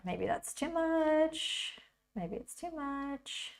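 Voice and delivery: high, drawn-out sing-song; slow, high-pitched mock reproach; playful self-chiding. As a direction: chide yourself lightly, stretched out and high. Chides herself by name, playfully.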